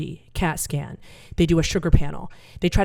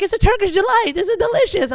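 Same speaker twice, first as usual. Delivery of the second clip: much higher and louder; high-pitched stoned dad; 14 semitones higher, 5 dB louder than usual. Retold or imitated. Comic voicing stoned dad listing breakfast, high and chirpy. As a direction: chirp it innocently. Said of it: "they're like".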